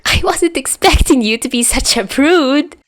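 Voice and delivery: high teasing howl; howling with laughter. Acted. Albatross teasing Chuya, high laughing howl. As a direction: crow it out, high and mocking. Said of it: "he howls".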